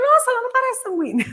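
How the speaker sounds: high, fast, amused disbelief; surprised people. Retold or imitated. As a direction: mimic people's light surprise with a laugh. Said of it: "muita gente fala".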